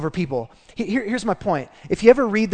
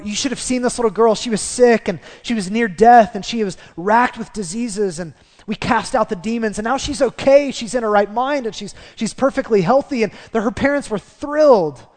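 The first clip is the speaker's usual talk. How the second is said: high, eager, breathless-paced storytelling; thrilled report; 3 semitones higher, 4 dB louder than usual. Acted. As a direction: share wonderful news about someone who was healed. Imagined disciples thrilled for healed girl.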